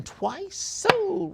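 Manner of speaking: very high, loud whoop; auctioneer's sold call. Real, not acted. Auctioneer closing the bidding, high whooped call. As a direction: let the word fly up like a hoot.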